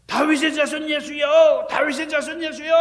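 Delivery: crying out loud and high to be heard; desperate shout. Retold or imitated. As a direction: call out to Jesus with all your breath. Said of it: "그래서 그냥 막 소리를 지르는 거예요".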